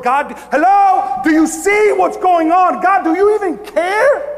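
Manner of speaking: high, loud, drawn-out challenge; exasperated, acted-out yelling plea; desperate cry to God. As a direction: shout upward as if nobody is listening. Acts desperate believer challenging God.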